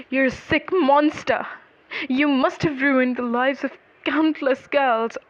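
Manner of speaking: a restrained narrated shout of outrage. A narrator's reading. Two victims accuse the man in court, shouting. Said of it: "The two victims started shouting".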